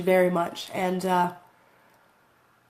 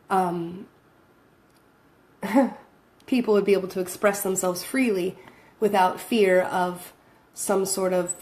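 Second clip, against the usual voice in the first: usual speaking voice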